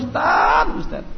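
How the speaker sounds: high, loud desperate plea to the preacher; a worn-out parent crying out for help; complaining parent. Retold or imitated. Parent pleading with the ustaz about waking the child, loud and high. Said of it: "yang curhat, yang ngeluh".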